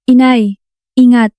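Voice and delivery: restrained cry of warning; warning shout. A narrator's reading. Child warning mother of danger, restrained shout. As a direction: call out a warning, not at full volume. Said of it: "Sigaw ko".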